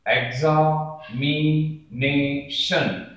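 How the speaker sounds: एग्जामिनेशन very slowly as एग्जा… मि… ने… शन; syllable by syllable. Shown as a demonstration. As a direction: stretch and separate each part of एग्जामिनेशन. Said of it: "बहुत ध्यान से देख लीजिए".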